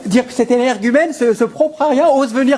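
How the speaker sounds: loud, outraged rant; indignant outburst. Acted. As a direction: spit out the outrage at the suitor. Outraged father rants about suitor.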